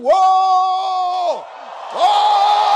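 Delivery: loud high whoa; excited shouting. Acted. Disciples shouting in excitement at the meeting. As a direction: whoop in amazement.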